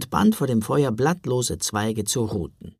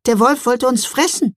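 indignant; 11 semitones higher, 5 dB louder than usual. Acted. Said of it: "entgegnete Mila entrüstet".

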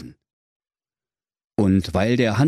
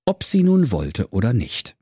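plain reading voice

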